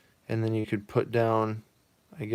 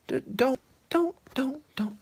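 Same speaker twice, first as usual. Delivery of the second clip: high whispered pleading; fading whispered don'ts; old mother voice. Acted. Mother pleads with Jimmy, high whisper. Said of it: "I don't have a good voice for her".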